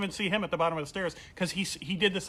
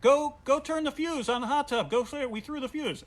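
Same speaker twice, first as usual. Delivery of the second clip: crowd demand; 9 semitones higher than usual. Retold or imitated. Crowd ordering him.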